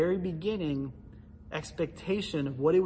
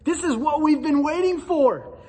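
higher and louder, fired up with a laugh; picture Peter thrilled the moment has come; breathless, rising hype as Peter; imagined Peter's excitement; 8 semitones higher, 5 dB louder than usual. Retold or imitated. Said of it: "I wonder if he's thinking".

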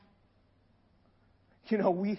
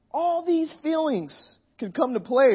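normal talking voice

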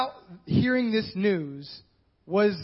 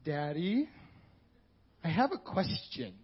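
put-on soft, sweet little-girl ask, lower and quieter; sweet daughter voice; 4 semitones lower, 7 dB quieter, slower than usual. Daughter buttering up her father, sweetly quiet. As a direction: coax Daddy gently to soften him up. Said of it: "they're like".

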